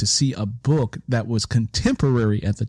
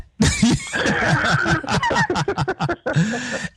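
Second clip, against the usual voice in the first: high burst of real laughter; laughing at her own memory. Real, not acted. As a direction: let a bright laugh break out.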